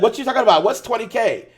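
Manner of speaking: high, loud wife voice asking what's up; high demanding wife voice. Retold or imitated. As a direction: play the suspicious wife barging in. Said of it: "And she's like".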